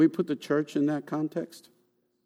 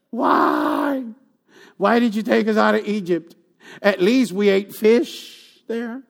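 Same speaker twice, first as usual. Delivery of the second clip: lightly mocking, higher whining complaint; whiny Israelites; 6 semitones higher, slower than usual. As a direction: whine like ungrateful people. Israelites complaining, voiced by preacher.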